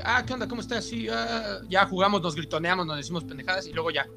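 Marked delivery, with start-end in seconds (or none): 0.0-1.6 high, breezy offhand hello; casual greeting. Retold or imitated. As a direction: toss off a careless greeting. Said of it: "sí es más de". (saludo casual y despreocupado)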